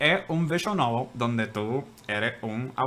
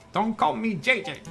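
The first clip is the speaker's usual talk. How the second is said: annoyed retort; 10 semitones higher than usual. Acted. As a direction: snap back at the nickname. Lawyer snaps back at nickname.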